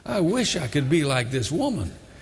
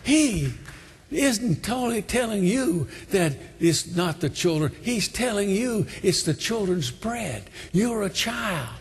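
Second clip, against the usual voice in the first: raised, insistent preacher's voice; fervent preaching; 7 semitones higher than usual. Real, not acted. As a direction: build from emphatic exhortation to high-pitched urgency. Preaches the altar call at full force.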